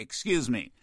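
ordinary talk, nothing marked

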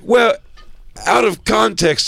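high-pitched, defensive excuse-making; backpedalling heckler. Acted. Heckler excusing himself after being confronted, high defensive voice. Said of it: "he's like".

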